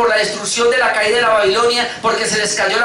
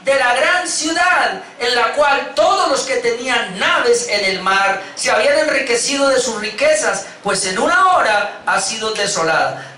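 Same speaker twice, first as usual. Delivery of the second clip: solemn scripture reading; 5 semitones higher than usual. Acted. Scripture read with music.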